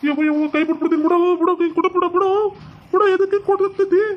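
high, distressed yelping; panicked cries. Acted. Man pecked by a crow, panicked high cries. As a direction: cry out to be let go. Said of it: "ಅಂತ ಕಿರ್ಚ್ಕಂತಿದ್ನಲ್ಲ".

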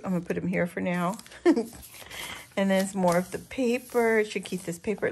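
ordinary talk, nothing marked

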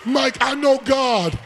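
high, loud sung whoop over the music; preaching. Acted. Preacher calling the congregation to holler, sung whoop. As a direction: hold the notes and shout the claim.

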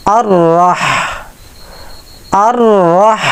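আর রাহ with the ha breathed from the throat; correct throat ha. Shown as a demonstration. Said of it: "এরকম একটা আওয়াজ বের করলে হাটা শুদ্ধ হবে".

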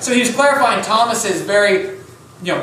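ordinary talk, nothing marked

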